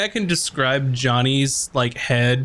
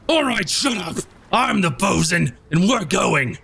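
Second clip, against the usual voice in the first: high bossy pirate voice; bosun Karzak; 5 semitones higher than usual. Acted. Karzak shutting up his squabbling crew, high bossy voice. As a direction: lay down the law as bosun. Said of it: "and then Kurzak goes".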